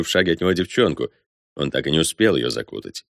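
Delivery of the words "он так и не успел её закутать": ordinary delivery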